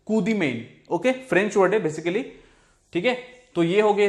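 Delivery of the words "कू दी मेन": normal talking voice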